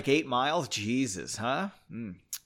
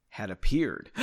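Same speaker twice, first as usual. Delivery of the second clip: ordinary delivery, nothing marked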